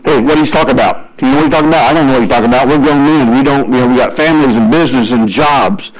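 a low-voiced mock protest from grown men; James and John. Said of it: "I can imagine James and John going".

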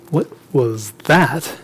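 hushed, slow and low; softly, word by word, spooked. Acted. Disciples hearing a screech in the dark, spooked and hushed.

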